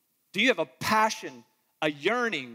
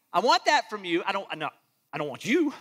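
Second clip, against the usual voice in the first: ordinary talk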